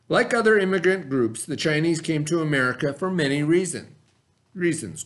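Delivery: steady read-aloud of the textbook. A narrator's reading. Teacher reading the lesson text to the class, read-aloud voice.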